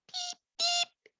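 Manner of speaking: very high, soft sickly bird peep; a sick little bird calling feebly; weak sick peep. Acted. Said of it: "in a weak, sick little voice".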